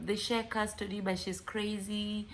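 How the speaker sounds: ordinary talk, nothing marked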